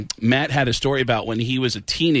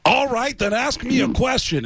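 high and pushy, daring her to test him; cocky rock star; 10 semitones higher than usual. Pretend. Caller posing as a rock star, daring the assistant to test him. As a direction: throw the challenge back like a star.

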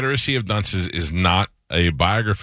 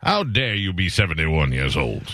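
lightly voiced scolding Southern judge; host's one character, a mock Southern judge scolding; old Southern judge; slower than usual. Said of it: "The old Southern judge".

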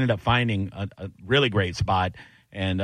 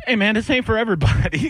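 imitating Bobby Kelly; 11 semitones higher, 4 dB louder than usual. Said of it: "he goes".

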